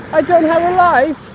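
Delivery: high, drawn-out mock whine; hammed-up pitiful wail; mock self-pity. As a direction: play the pathetic loner. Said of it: "you're gonna be sitting in the corner".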